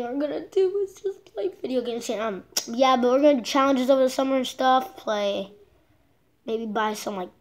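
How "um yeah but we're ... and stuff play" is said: ordinary talk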